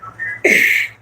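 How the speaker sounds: breathless laugh. Real, not acted. Laughs at her story.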